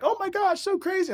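squealing "oh my gosh" imitation; excited banker. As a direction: ham up the amazed Londoner.

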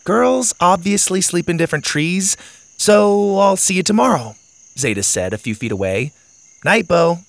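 put-on cheerful girl signing off. Zeta says goodbye.

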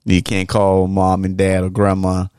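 normal talking voice

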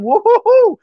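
high, loud whoop; excited cheer. Real, not acted. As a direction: whoop it up with joy. Cheering for Australian fan.

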